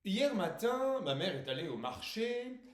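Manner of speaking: high, loud declaimed verse, last word drawn out; poetry recitation. Acted. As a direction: declaim it as a grand poem. Poet begins reciting his poem. (récitation de poème déclamée)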